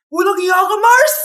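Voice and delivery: hammed-up betrayed yell; outraged. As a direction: explode at a treacherous friend. Betrayed friend curses rival.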